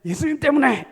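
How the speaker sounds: loud, high complaining outburst; frowning lament. Shown as a demonstration. Preacher showing how not to answer "because of Jesus", loud scowling lament. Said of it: "인상 막 찡그리고 한탄하는데".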